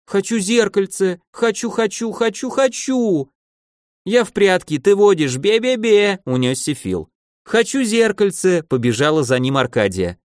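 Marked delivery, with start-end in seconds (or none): 0.1-3.3 put-on high childish tantrum, stretching the end; whining child. A narrator's reading. Child demanding a mirror, playacting. 4.1-6.2 high playground taunt; bratty, high-pitched jeer; teasing child. A narrator's reading. Childish teasing while running off. 7.4-8.6 put-on high-pitched childish demand; bratty insistent cry; whining child. A narrator's reading. Repeats childish demand, chasing him.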